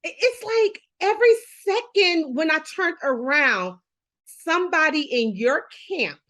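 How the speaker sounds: high, choked-up voice; close to tears. Real, not acted. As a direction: hold back real tears while talking. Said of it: "I might cry with you".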